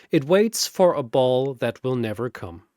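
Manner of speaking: usual speaking voice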